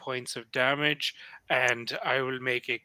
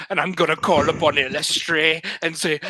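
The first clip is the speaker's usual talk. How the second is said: normal talking voice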